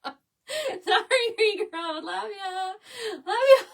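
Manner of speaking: high, loud, breaking into laughter; real laughing fit, words squeezed out; laughing hard. Real, not acted. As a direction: talk through helpless giggles. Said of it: "I'm laughing so hard".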